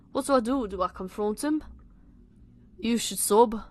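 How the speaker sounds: put-on Scouse; bad Liverpudlian accent. Reader redoing Tom's line as British, bad Liverpudlian accent. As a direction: read it in a shaky attempt at Liverpool accent. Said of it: "my shit Liverpudlian accent".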